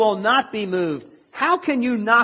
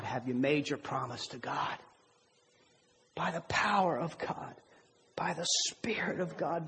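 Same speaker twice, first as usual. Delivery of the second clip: preaching appeal; 4 semitones lower than usual. Acted. Preacher closing the sermon to his church, rising appeal. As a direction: press the question on the congregation.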